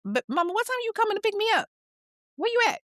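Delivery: put-on high, quick voice of a young girl asking after her mama; little girl voice. Daughter asking her mama where she is, in a high childlike voice. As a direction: pitch way up like a kid waiting to be picked up.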